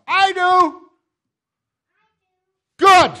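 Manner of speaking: loud, high preaching cry to the crowd; fervent altar call. Real, not acted. Pastor shouting the question to his congregation, fervent and loud. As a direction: demand an answer at full force, shouting.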